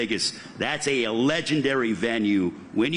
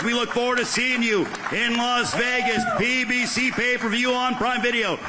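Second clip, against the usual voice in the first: fight promoter; 6 semitones higher than usual. Acted. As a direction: pump up the crowd like a ring announcer. Host closes with promo announcement.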